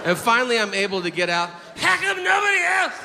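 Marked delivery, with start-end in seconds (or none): 1.8-3.0 very high, choked squeal through suppressed laughter; strangled laughter. Retold or imitated. Ten-year-old in church trying not to laugh, strangled squeal. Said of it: "It sounds like I'm having a stroke".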